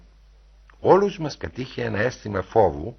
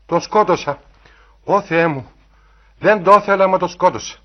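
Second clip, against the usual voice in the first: high, loud, horrified confession; raised, panicked wail of guilt; cry of terror; 7 semitones higher, 7 dB louder than usual. A narrator's reading. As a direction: cry out aghast at your own deed. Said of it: "ακούστηκε μια κραυγή τρόμου από την Ταϊάννα".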